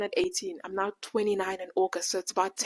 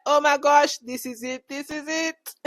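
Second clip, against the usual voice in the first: excited; 6 semitones higher, 5 dB louder than usual. Retold or imitated. Thrilled at finding the name.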